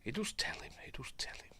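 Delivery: barely voiced, hushed to himself; a private whispered aside. Real, not acted. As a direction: whisper it under your breath at the screen. Muttering at the film on screen.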